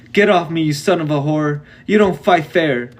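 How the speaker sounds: put-on pinned bully yelling at the boy on his back; furious yell. A narrator's reading. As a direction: spit it out pinned and hurting. Pinned boy screaming in pain.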